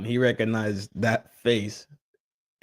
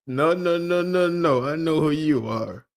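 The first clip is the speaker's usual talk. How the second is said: imitating a refusing grandma, pitched up; a hint of grandma, higher, repeated noes; lightly voiced grandma; 6 semitones higher than usual. Grandma turning people away, lightly voiced refusal. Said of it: "Grandma said".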